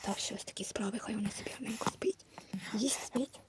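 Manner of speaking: very soft near-whisper; hushed. Pretend. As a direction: keep it down by a sleeping baby. Baby is falling asleep.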